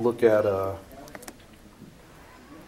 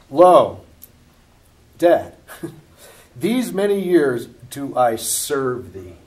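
indignant son; 8 dB louder, much slower than usual. Acted. Voicing elder son complaining to father.

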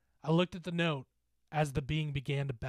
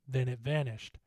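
usual speaking voice

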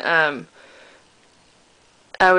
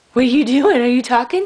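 high cooing voice for a kitten; 7 semitones higher, 5 dB louder than usual. Real, not acted. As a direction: coo at the kitten, high-pitched.

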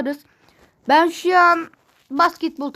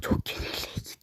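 breathy whispered lament; whispering; 11 dB quieter than usual. Pretend. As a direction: whisper it in mock despair. Losing the game, lament.

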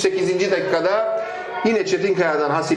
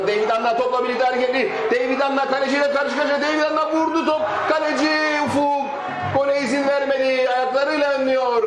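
high shouted chance call; screaming football commentator; 5 semitones higher than usual. Real, not acted. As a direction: shout the one-on-one and save. One-on-one with keeper, save.

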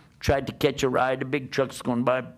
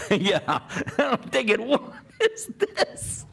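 laughing, high breathless whispered question; 7 semitones higher, slower than usual. Real, not acted. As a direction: giggle through the line in disbelief. Laughing at own smelly situation.

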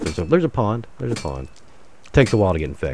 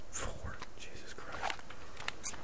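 hushed whisper; 16 dB quieter, much slower than usual. Real, not acted. Awed at the game view.

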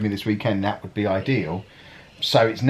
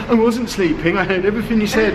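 mimicking Anna; 9 semitones higher than usual. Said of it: "she'd argue in the morning and say".

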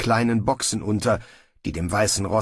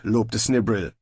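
usual speaking voice

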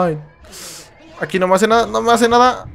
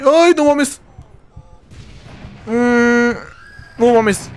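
startled cry; 7 semitones higher, much slower than usual. Real, not acted. Crowd of enemies appears.

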